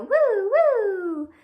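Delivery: high, loud playful woo woo. Acted. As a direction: whoop like a spinning yo-yo. Yo-yo noises in the rhyme.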